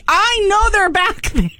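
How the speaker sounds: accusing them of hiding stock; suspicious insistence. Retold or imitated. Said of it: "And I'm like".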